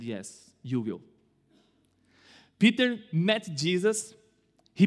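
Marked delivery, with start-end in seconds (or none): 0.0-1.0 quiet. Acted. Jesus' quiet reply to Peter.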